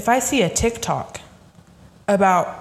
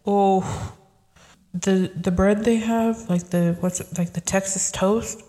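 usual speaking voice

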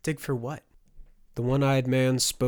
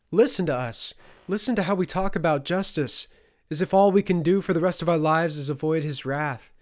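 louder, higher rallying speech; impassioned appeal to the whole room; addressing a crowd; 6 semitones higher, 6 dB louder than usual. A narrator's reading. Sophia addresses the rest of the peasants, rallying them loudly. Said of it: "she addressed the rest of the peasants".

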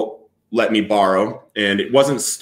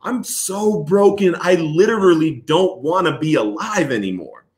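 higher-pitched, stretched-out despair; broken, retold outcry; 6 semitones higher than usual. Man at breaking point crying out to God, retold despair. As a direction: relive the breakdown, leaning hard on the words. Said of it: "I'm yelling at him and I'm crying".

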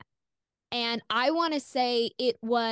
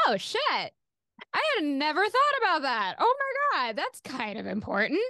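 higher, alarmed "oh shit" moment; sudden realization; 6 semitones higher than usual. Retold or imitated. Young woman realizing something important, alarmed and higher. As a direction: it suddenly hits you, voice jumping up. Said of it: "And suddenly I was like".

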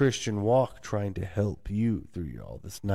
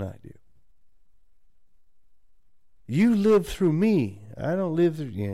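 scolding parent; 7 semitones higher, slower than usual. Retold or imitated. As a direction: lecture him slowly and sternly, voice raised. Family scolding the son.

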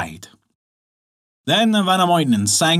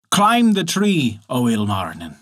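ordinary delivery, nothing marked

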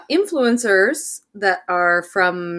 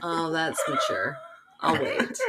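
mock teacher retort; 5 dB quieter, slower than usual. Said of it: "And then I said".

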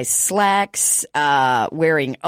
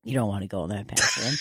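low, hushed, much softer woman's warning; lowered secretive voice; quiet warning; 10 semitones lower, 9 dB quieter than usual. Retold or imitated. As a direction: confide the danger in a low murmur. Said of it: "she goes".

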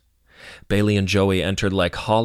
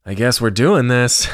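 loud, higher, ending in a laugh; proclaimed; 4 semitones higher, 4 dB louder than usual. A narrator's reading. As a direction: announce it boldly, then chuckle. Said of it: "Joey proclaimed".

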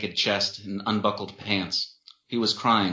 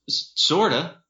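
stammering through tears; choked up; 8 semitones higher, 4 dB louder, slower than usual. Acted. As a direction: try to hold back a sob. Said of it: "tried to compose himself".

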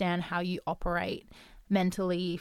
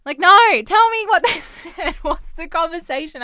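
much higher and louder, laughing through it; frustrated plea; 11 semitones higher, 14 dB louder than usual. Retold or imitated. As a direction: beg for the whole story, half laughing. Pleading with partner for details.

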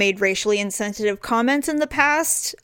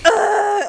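disgusted ugh; 11 semitones higher, 5 dB louder than usual. Retold or imitated. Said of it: "And I'm like".

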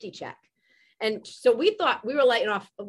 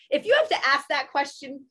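higher, louder mock-pointed comeback; retold sassy retort; 4 semitones higher, 6 dB louder than usual. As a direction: deliver the comeback big, breaking toward laughter. Retold retort to neighbor's text.

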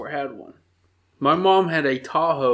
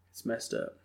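a whispered, defeated admission; 14 dB quieter than usual. Real, not acted. Friend whispering to cohost that the new TV is broken. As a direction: whisper it like bad news.

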